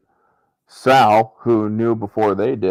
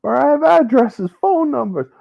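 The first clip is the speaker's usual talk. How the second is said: eager pitched-up informant blurting out everything; a snitch eager to please, voice pitched way up; eager high voice. Retold or imitated. Informant eagerly pitching himself to the brothers, high and rushed.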